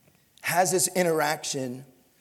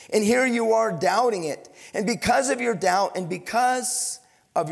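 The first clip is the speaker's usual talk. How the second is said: louder, higher preacher voice; preaching; 6 semitones higher, 5 dB louder than usual. Acted. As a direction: drive the point home to the congregation. Preaching at full force.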